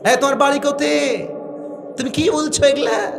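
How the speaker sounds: high mock Rajshahi accent; Rajshahi dialect. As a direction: play a Rajshahi local quizzing a stranger. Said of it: "Rajshahi গেলে দেখবেন এভাবে কথা বলে".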